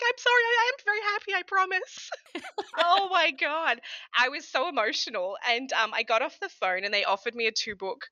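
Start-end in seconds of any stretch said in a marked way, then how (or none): 0.0-2.1 high, tearful imitation of promising she's happy; retold tearful apology. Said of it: "I was crying because I had this". 2.2-3.8 slow, laughing Oh my God; laughing exclamation. Real, not acted. Laughing at her own story.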